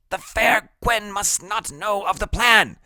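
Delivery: put-on very high, grandiose kid voice; high-voiced little schemer; brainy, secretive Freak. A narrator's reading. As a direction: pitch it way up, conspiratorial.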